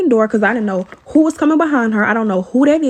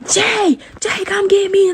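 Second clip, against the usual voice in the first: retold scream; 8 semitones higher, slower than usual. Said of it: "she started screaming like".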